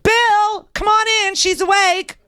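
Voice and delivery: very high sing-out call to someone outside. Retold or imitated. Doctor summoning a colleague to meet patient, calling out. Doctor calls Bill into the room.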